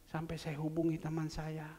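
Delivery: normal talking voice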